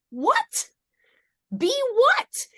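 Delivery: very high, loud incredulous questions; baffled disbelief. Retold or imitated. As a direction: squawk it back, acting out total bewilderment. Acting out her baffled reply.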